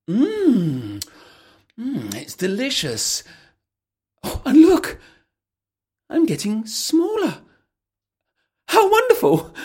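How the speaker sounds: put-on high, loud childlike delight; Alice thrilled to be getting smaller; delighted girl. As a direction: squeal with wonder as you shrink. Alice drinking and shrinking.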